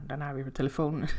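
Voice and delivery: ordinary delivery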